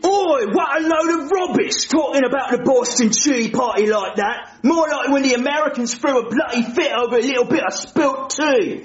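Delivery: huffy put-on British accent; offended British accent; British accent, indignant. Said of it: "I don't like his accent".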